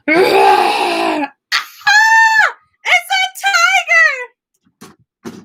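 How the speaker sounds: hammed-up scream, then high "it's a tiger"; mock tiger fright. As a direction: pretend to be scared for the kids. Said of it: "I go".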